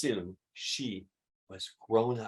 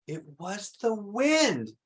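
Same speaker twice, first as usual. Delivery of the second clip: slow, higher, wondering delight; delighted realization; 7 semitones higher, much slower than usual. Acted. Kneeling Miss Rumphius realizing the wind spread her seeds, slow delight. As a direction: let the discovery dawn slowly. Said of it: "she said as she knelt in delight".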